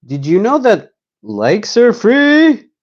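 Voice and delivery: high, slow mock-grand plug; comic high voice. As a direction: drag out the subscribe plea, hammed up.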